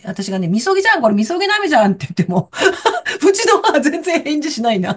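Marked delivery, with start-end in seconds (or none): 0.5-1.9 high, lightly acted gleeful insistence; retold excitement. Daughter to silent mother about the purifying rain, mock-excited. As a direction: re-enact trying to share the thrill.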